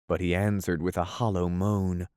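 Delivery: ordinary delivery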